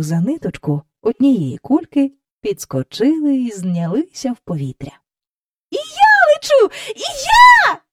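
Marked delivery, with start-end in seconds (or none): 5.7-7.8 put-on high, loud girlish squeal; squealing child. A narrator's reading. Jane shrieking with delight as she lifts off. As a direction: shriek it like a little girl flying. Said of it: "заверещала Джейн".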